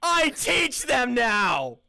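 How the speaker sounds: high, full-volume comeback; triumphant shout. Acted. Shouting back at his doubting brother, triumphant. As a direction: yell it to be heard downstairs.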